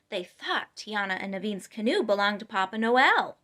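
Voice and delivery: plain reading voice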